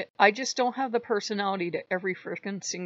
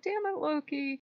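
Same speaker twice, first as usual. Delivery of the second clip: high-pitched, exasperated complaint; mock exasperation; 9 semitones higher, 3 dB quieter than usual. Speaker fed up with Loki's screeching, mock exasperation.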